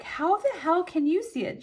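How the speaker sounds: high, loud mock disbelief; retold incredulity. Asking her friend who could read the bill, incredulous. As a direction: throw it out high, as if astounded.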